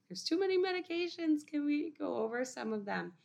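mock student question. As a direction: play a student overwhelmed by the list. Student asking about too many medications.

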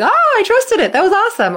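high, loud burst of delight; excited delight. Acted. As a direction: squeal it like you just nailed the skill. Gymnast after trusting a skill.

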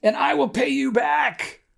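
high, pleading plea, slowing at the end; a fellow servant begging on his knees. A narrator's reading. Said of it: "begged him".

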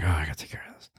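whispered, low weary grumble; exhausted aside under the breath; worn-out mutter to self. Acted. Tired mover muttering to himself over the mess, weary whisper.

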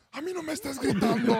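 reclamo recreado, muy agudo y fuerte; grito al coach. Retold or imitated. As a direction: encara al coach a gritos, actuándolo. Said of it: "Nunca le había gritado a un coach". (estallido agudo de jugador harto, imitado)

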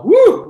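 high, loud whoop of relief; relieved whoop. Real, not acted. Preacher whooping after getting through a tongue twister. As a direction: let out a whoop after a hard sentence.

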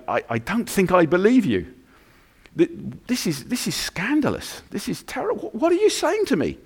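a scandalised fiancé, pitch climbing; upset Joseph. Acted. Joseph answering Mary, high and distraught.

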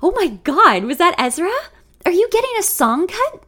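high, loud, breathless-with-news gush; excited. A narrator's reading. Lexi quizzing her friend about the phone call, excited. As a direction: squeal the questions in excitement. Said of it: "Lexi looks at me excited".

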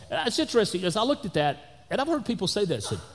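normal talking voice

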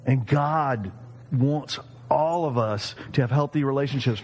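usual speaking voice

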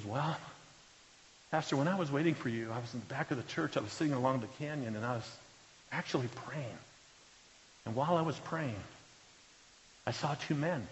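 a mild hint of a trembling man confessing; grieving husband quietly telling his pastor, shaken; shaken, hushed. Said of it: "But he's really trembling".

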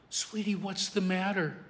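very soft, soothing question; tender hush. Retold or imitated. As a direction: ask it almost under your breath to calm a child. Father soothing his crying daughter.

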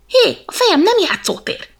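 high, loud fed-up cry; fed-up shout. Acted. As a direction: tell him off sharply. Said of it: "unta meg a kis elefánt".

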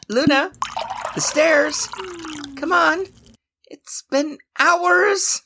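high-pitched impatient plea; nagging, high, stretched at the end; pleading. Acted. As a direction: plead to be let off the stairs. Stuck apprentice pleads to be freed.

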